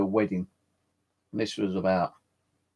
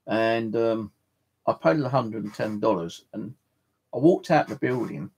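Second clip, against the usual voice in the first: ordinary delivery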